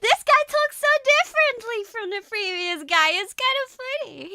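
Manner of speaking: high, loud, laughing through the words; giggling amusement. Real, not acted. Streamer amused by the new teacher, high giggly delight. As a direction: squeal it through helpless giggles. Giggling over the different accent.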